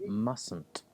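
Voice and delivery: "Mustn't" with the first T silent; no T after the S in "Mustn't"; dropped T. Shown as a demonstration. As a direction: say "Mustn't" like "muss-nt". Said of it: "we drop the T in this".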